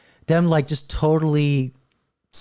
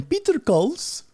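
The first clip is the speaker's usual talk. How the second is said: higher, drawn-out mock Indian accent; imitation Indian accent; 13 semitones higher, slower than usual. Shown as a demonstration. Host showing how Peter sounds before the software, mock Indian accent. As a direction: put on an Indian accent, slowly. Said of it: "it will soften your accent".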